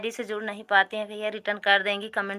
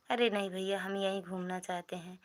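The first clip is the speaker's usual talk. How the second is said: ordinary delivery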